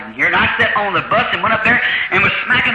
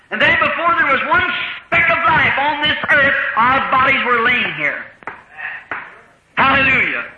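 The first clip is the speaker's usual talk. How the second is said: full-force preaching; 6 semitones higher than usual. Acted. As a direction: preach it out to the congregation, rising.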